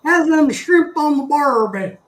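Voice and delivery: loud, high-pitched attempt at an Australian accent; slow, hammed-up Aussie accent. Shown as a demonstration. Streamer trying out an Aussie accent on Greg, hammed-up Australian accent. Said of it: "try to do my Australian accent".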